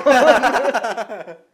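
high hearty laugh; big helpless laugh; loud burst of laughter. Real, not acted. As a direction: crack up loudly. Reacts to joke.

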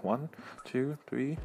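quiet, tentative counting under the breath; counting on fingers. Retold or imitated. Kid counts on his fingers for the teacher, softly counting. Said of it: "he goes to his fingers".

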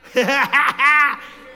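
big loud burst of laughter. Real, not acted. Pastor cracking up at his own car story, loud laugh.

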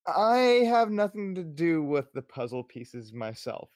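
slow, emphatic in-character warning; deliberate warning. Acted. Nork gets one last word in, slow warning. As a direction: draw the words out as a measured threat.

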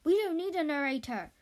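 loud dismissal. Acted. Director dismissing the narrator, loud. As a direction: shut him down.

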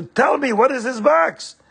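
demanding wife. Acted. Wife demands about hidden box.

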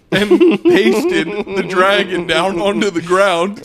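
laughing through the words; can barely get it out for laughing. Real, not acted. Laughing while telling prank.

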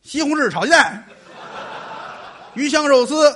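a restaurant crier's shouted menu item; loud dish-name calling. Acted. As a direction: announce the dish to the whole hall. Reciting dish names on stage.

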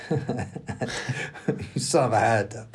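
"Ahitub" drawn out slowly, syllable by syllable; slow careful retake. Real, not acted. A reader laughs at stumbling and rereads Ahitub very slowly. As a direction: retakes the tricky name slowly after laughing.

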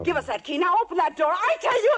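high, loud shouting, climbing to the end; frantic shouted demand. Acted. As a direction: yell orders in desperation. Trapped, shouting at the captor.

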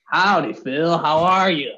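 put-on Southern US twang; Southern accent. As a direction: greet him in a hammed-up Southern accent. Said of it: "I'm gonna have a Southern accent".

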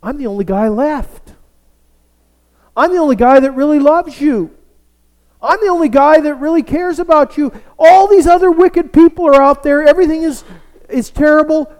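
whining Elijah. Retold or imitated. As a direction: whine it like a sulking prophet who feels alone. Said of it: "And he says".

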